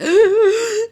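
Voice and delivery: sharp, high, loud gasp; shocked gasp. Retold or imitated. Turning round to Tori on spotting the founder, shocked gasp. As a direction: re-enact a big startled gasp. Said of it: "literally gasped".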